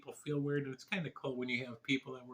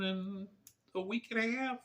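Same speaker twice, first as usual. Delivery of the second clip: getting emotional; 8 semitones higher than usual. Real, not acted. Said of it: "I'm getting emotional".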